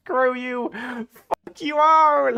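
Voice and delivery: high, hammed-up slow fuck you all; mock angry outburst. Streamer acts out rival's misspelled insult, mock rage, high. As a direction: overdo the rage of a typed insult. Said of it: "He said".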